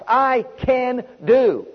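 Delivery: drawn out slowly, each word weighted; slow emphatic declaration. Shown as a demonstration. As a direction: let every word land. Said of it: "they unleash the ability of God".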